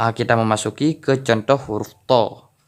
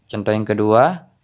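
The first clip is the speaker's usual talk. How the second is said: ordinary talk, nothing marked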